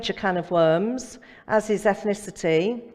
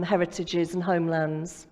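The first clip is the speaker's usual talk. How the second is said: ordinary talk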